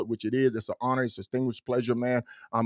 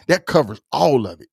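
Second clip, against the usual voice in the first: usual speaking voice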